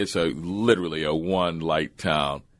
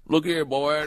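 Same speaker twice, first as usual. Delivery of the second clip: lightly voiced Texan local, higher pitch; higher-pitched imitation of a local; 8 semitones higher, slower than usual. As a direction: call out like a small-town Texan. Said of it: "you get to Texas, like".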